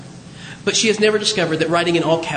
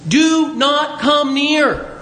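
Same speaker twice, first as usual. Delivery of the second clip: very slow, higher-pitched command; 6 semitones higher, much slower than usual. Acted. God halting Moses at the bush, slow command. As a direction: order a halt word by word.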